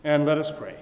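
usual speaking voice

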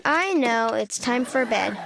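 ordinary delivery

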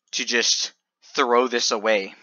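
ordinary talk, nothing marked

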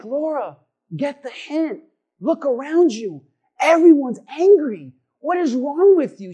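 high, loud, drawn-out pleading rebuke; exasperated. Retold or imitated. Angry passenger scolding giddy Laura, exasperated. Acted exasperation at cheerful stranger.

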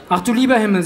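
a hammed-up gasp of concern; mock dismay. Father reacting to his son crying, mock dismay.

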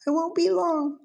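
high squeaky parrot voice; imitating the parrot. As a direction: mimic the bird saying goodbye. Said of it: "yeah, he says".

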